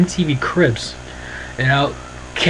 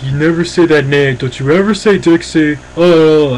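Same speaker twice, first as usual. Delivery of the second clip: a touch louder, stern scolding; mock angry Jeff; 4 dB louder than usual. Jeff scolds the kids for saying Dixie, mildly mock angry. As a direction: lightly act out an upset dad snapping at the kids. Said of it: "Jeff's like".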